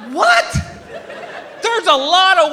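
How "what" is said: high squeaky disbelieving what; shocked what. Retold or imitated. Comic hearing his wife's zipline plan, squeaks a shocked high what. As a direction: react like you just heard the worst idea.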